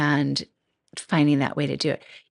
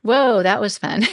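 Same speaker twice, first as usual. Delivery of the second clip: slow, louder, thrilled "Whoa, that was fun"; delighted whoa; 4 semitones higher, 7 dB louder than usual. Pretend. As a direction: turn the shock into a happy cheer. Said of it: "You turn it into".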